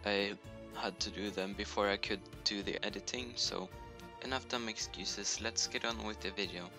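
ordinary talk, nothing marked

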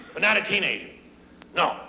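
usual speaking voice

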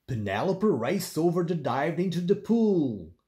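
plain reading voice

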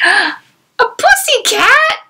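gasp, then a very high, slow amazed question; gasping surprise. Pretend. Storyteller pretending amazement at a cat picture for Gemma, high gasping surprise. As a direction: play big wonder for a child.

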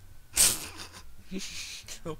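high breathy giggle; unvoiced giggle trailing down. Real, not acted. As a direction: giggle at the cutscene. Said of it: "Why am I laughing?".

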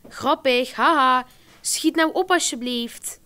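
mock her excuse, then insist; sarcastic. A narrator's reading. Mother sarcastic about the bike-lamp excuse, slow insistence.

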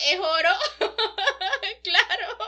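riendo a carcajadas; laughing. Real, not acted. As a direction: se le escapa la risa al hablar. Se ríe contando la escena. (hablando entre risas)

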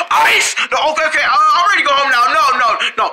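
high, hurried panicky refusal; alarmed protest. Acted. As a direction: blurt it out, desperate to escape.

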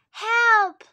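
high, loud storybook cry for help. A narrator's reading. Boy in the sea as a wave comes, crying out. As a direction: cry out loudly as the boy in danger. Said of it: "he cried".

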